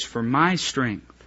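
hushed, reverent reading of God's promise; solemn and tender. Acted. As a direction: let the verse settle gently. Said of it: "I want you to notice this".

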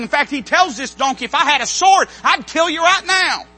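loud, driving sermon delivery; preaching at full force. Acted. Preacher telling church about Balaam, loud and fervent. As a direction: build the story with rising fervour.

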